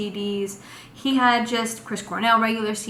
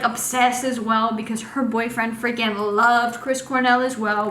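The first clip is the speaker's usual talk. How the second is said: ordinary talk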